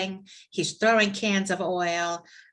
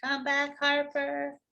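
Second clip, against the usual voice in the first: playful calling out; 7 semitones higher, 3 dB quieter, slower than usual. Pretend. Host summoning a missing participant back, high playful call.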